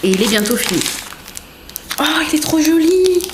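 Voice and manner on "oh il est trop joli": high, gushing exclamation; delighted gushing. Real, not acted. Vlogger unwraps a notebook gift, gushing with delight. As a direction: coo loudly at something very pretty.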